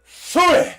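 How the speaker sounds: high, loud mock spit out the window; spitting sound. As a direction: blast a loud imitated spit. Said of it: "rolled the window down and went".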